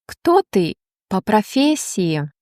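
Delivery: ordinary talk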